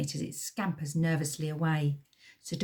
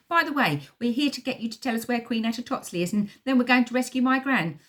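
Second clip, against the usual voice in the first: blurted; 6 semitones higher, 4 dB louder than usual. Pretend. Imagined blurted confession.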